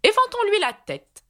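high, unctuous queen. A narrator's reading. Red Queen proposing to fan Alice, high unctuous voice. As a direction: pour it out high and oily. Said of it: "d'une voix onctueuse".

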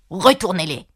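curt, cross royal command; wrathful order. A narrator's reading. Angered queen orders the valet about: wrathful command. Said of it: "d'un air courroucé".

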